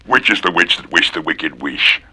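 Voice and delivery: soft, low witchy murmur; low witch. Acted. Witch asking softly, low and sly.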